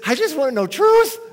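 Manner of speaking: put-on high, loud cry of a half-drowned young man; desperate gasping plea. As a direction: plead for truth, gasping after near drowning. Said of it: "he's gasping for air".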